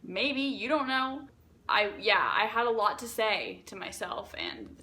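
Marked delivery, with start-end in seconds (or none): 0.0-1.3 high, sing-song feigned innocence; mock-innocent, pitched way up; playful cover-up act. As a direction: act casual in a much higher voice for passers-by. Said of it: "when people would walk by, I was like".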